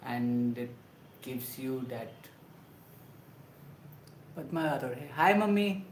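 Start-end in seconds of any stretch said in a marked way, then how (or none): 5.1-5.9 excited greeting. Real, not acted. Greeting mother joining the stream.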